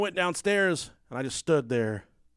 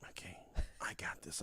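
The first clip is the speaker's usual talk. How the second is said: whispered; 9 semitones lower, 16 dB quieter than usual. Retold or imitated. Whispered plan to himself.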